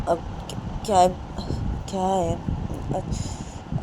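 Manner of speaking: soft, half-whispered, frightened replies; scared daughter. Acted. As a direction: shrink back and barely speak. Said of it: "डर डर बैठी थी". (डरी हुई पूनम पापा को धीमी, सहमी आवाज़ में जवाब देती है)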